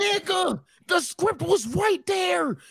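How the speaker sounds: high, drawn-out exasperated whine; exasperated protest. Real, not acted. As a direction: squeal it slowly in disbelief. Protesting the scripted storyline.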